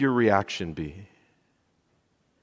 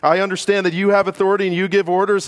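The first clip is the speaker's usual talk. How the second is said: centurion quote; 10 semitones higher, 5 dB louder, faster than usual. Acted. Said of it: "He says".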